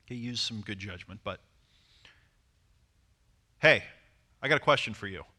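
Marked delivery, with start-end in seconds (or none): 3.6-5.3 firm, louder opener; authoritative. Shown as a demonstration. Man opens a conversation with a woman, authoritative. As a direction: state it with authority. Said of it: "I sound authoritative as I say it".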